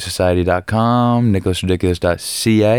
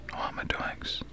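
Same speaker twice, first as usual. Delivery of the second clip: unvoiced whisper; whispered plea; 17 dB quieter than usual. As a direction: whisper it like a secret. Repeats plea to Mike whispered.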